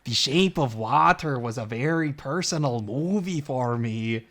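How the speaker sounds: a mocking, drawn-out Mexican accent on a director's sentiment; Guillermo del Toro impression. Joking about a film to a friend, in a hammed-up Mexican accent. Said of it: "awful Del Toro impression".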